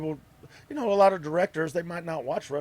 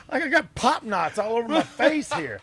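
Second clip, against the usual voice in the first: ordinary delivery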